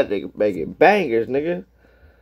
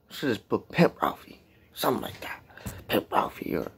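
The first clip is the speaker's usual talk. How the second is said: whispering; 9 dB quieter than usual. Real, not acted. Muttering names to himself.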